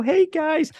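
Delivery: high-pitched put-on mid-Atlantic accent; mock a cousin's mid-Atlantic accent. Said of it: "already sounds like mid-Atlantic".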